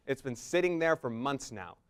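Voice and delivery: normal talking voice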